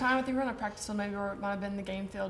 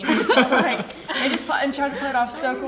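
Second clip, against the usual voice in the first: talking through laughter, louder; laughing; 4 semitones higher, 9 dB louder than usual. Real, not acted. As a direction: laugh out the story as you tell it. Laughing while telling story.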